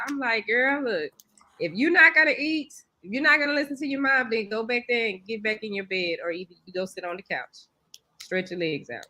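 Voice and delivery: ordinary talk, nothing marked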